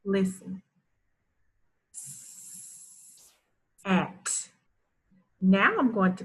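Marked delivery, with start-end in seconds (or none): 1.9-4.5 long hissed S, pause, then "at"; word in parts. Shown as a demonstration. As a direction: break it into "S-" and "at". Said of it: "say a word in parts".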